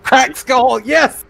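high-pitched triumphant exclamation; real gleeful outburst; excited cheer. Real, not acted. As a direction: celebrate a brutal lucky hit. Celebrating a critical injury result.

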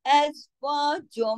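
slow अज़वाजुम, humming on the final m; long ghunna. Shown as a demonstration. Teacher models the merger, अज़वाजुम with nasal hum. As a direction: recite slowly and hold the m in the nose. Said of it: "बड़ा गुन्ना होगा".

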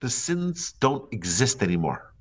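ordinary talk

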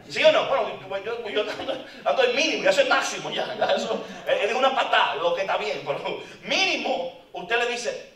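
loud preaching through laughter; laughing. Real, not acted. Preacher joking with his church, loud and laughing. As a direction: laugh as you tease the congregation.

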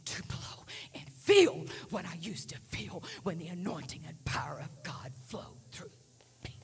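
slow, hushed, aching confession. Retold or imitated. Retelling [name]'s regretful confession.

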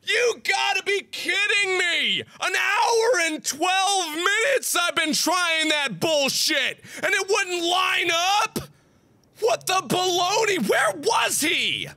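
half-crying shouts, high and slow; dragged-out wailing complaint; anguished yelling. Real, not acted. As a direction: rage tipping into tears. Gamer breaking down after failure.

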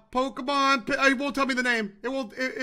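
ordinary talk, nothing marked

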